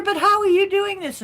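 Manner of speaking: high, loud, bewildered question; retold panicked cry. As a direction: shriek it like the frightened woman again. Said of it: "She's there".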